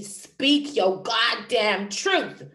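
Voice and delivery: drawn-out, forceful order; emphatic command. Acted. Throat chakra ordering the speaker to speak up, slow and emphatic. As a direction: stretch every word out slowly and push it hard. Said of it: "My throat chakra was telling me".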